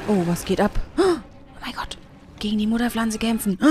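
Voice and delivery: normal talking voice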